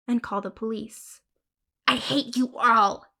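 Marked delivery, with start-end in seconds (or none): 1.9-3.1 a spiteful, louder snarl; spat hatred. Acted. Little girl spitting hate at her family. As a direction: spit the words at the family. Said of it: "she would spit".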